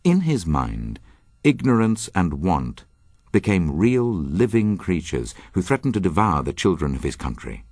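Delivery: ordinary talk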